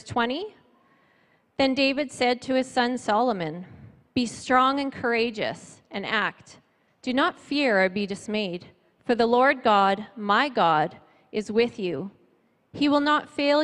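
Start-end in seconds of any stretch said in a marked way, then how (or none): none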